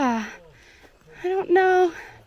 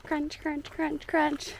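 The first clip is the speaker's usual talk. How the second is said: soft, hushed crunch sounds mimicking footsteps; quiet playful crunch imitation; 4 semitones higher, 6 dB quieter, much slower than usual. Shown as a demonstration. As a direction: whisper the crunch of gravel underfoot. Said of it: "it feels so good to hear that crunch".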